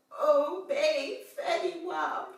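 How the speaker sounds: put-on bedroom voice that misses tough; failed aggressive voice. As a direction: imitate a guy trying to sound aggressive. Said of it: "don't have the aggressive voice".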